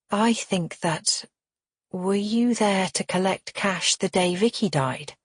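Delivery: usual speaking voice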